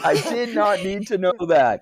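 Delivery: high, loud protest; appalled outburst. Real, not acted. Telling the others it was too much, appalled.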